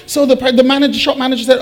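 normal talking voice

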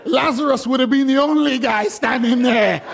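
high, laughter-filled voice; amused exclamation. Real, not acted. Preacher joking that all would flee Lazarus, high amusement. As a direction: deliver the punchline high, still cracking up.

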